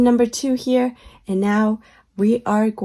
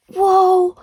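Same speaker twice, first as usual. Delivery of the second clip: surprised whoa; 8 semitones higher, 7 dB louder than usual. Acted. Excited reveal.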